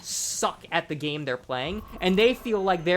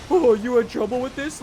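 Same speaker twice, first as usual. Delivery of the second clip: sneering mock sympathy, higher and louder; 7 semitones higher, 7 dB louder than usual. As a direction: taunt like a smug Reddit commenter. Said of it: "making fun of the guy".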